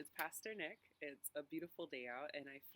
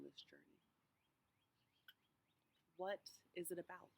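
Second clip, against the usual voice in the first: unhurried meditation voice with long pauses; guided meditation; 3 semitones higher than usual. Real, not acted. Pastor walking congregation through a walk to Emmaus, guided meditation. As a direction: guide listeners gently, phrase by phrase, leaving space to breathe.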